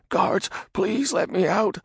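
high, slow frightened plea; pleading. Acted. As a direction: beg to be let out. Radagon pleads.

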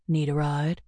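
a lightly voiced dark stranger; raspy dark voice. A narrator's reading. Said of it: "the raspy, dark voice behind her".

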